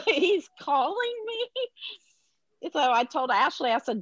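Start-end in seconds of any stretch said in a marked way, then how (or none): 0.1-2.7 high, drawn-out disbelief; incredulous. Retold or imitated. Mother recalling her shock that Josh is calling, incredulous. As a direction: stretch it out high in mock disbelief.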